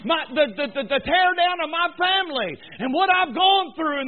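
high, loud preaching that rises into shouts; full-force preaching. Acted. Preacher answering the congregation's excuses, shouting. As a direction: hammer every line and finish shouting.

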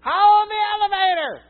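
put-on high, loud old man's holler. Old man on a walker hollering from the door, high and loud. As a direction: holler across the lobby to hold the elevator. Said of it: "hollers out".

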